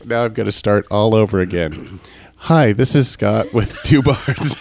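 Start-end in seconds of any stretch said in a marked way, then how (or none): 2.4-4.6 laughing. Real, not acted. Restarting intro, laughing.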